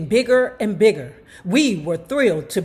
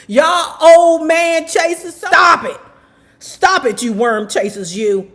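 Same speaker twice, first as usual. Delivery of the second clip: wag a finger at them, laughing inside; teasing scold; 7 semitones higher, 6 dB louder than usual. Real, not acted. Teasing comment readers.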